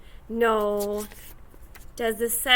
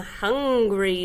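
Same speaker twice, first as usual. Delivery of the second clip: ordinary talk, nothing marked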